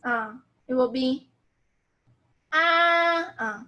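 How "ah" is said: "ah" held long on one level pitch, loud; first tone. Shown as a demonstration. Teacher showing learners the first tone, "ah" long and level. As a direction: hold "ah" perfectly steady, no change. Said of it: "first tone, how do we pronounce this?".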